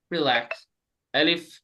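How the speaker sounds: normal talking voice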